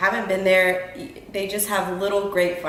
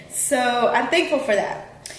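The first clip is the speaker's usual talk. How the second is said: usual speaking voice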